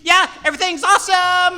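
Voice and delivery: high, very loud exclamation; over-cheerful cover-up. Shown as a demonstration. Blackmailed Shadow reassuring the manager, overdone cheerful shout. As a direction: blurt it far too brightly and loudly. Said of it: "thinking of the Lego Movie".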